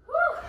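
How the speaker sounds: high, loud breathless whoop with a laugh; laughing whew. Real, not acted. Instructor mid-workout, a high laughing whew. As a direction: blow it out high, laughing at the strain.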